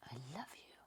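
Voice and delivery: breathy whisper, rising at the end; changed voice. Shown as a demonstration. Coach showing how voice changes the message, whispered. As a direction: whisper it very softly. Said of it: "watch this with my voice".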